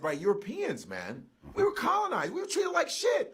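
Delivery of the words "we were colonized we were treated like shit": heated shout. Real, not acted. Angry rant about colonization.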